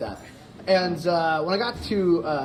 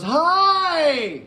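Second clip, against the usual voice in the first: mock teacher; 10 semitones higher, much slower than usual. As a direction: overdo it like talking to someone deaf. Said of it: "she goes".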